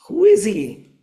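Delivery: startled, wanting to know at once; Krishna surprised, asking about the stranger. A narrator's reading. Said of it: "Krishna was very surprised".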